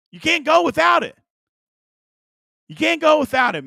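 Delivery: emphatic insistence. Real, not acted. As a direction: insist the viewers can't skip it.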